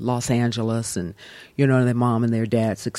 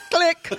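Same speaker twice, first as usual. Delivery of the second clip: high, louder mouth click; phone click noise; 4 dB louder than usual. Retold or imitated. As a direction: make a hang-up click sound. Imitating hanging up the phone.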